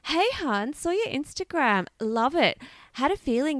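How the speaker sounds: high, chirpy fake-friendly DM voice; sugary hey-hun recruiter; mlm recruiter pitch. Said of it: "suddenly they'll slide into your D Ms with a message".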